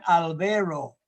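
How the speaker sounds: Albero with e instead of a, stress on the be; Canadian mispronunciation. Retold or imitated. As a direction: mimic Canadians saying Albero. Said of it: "no podían pronunciar mi nombre".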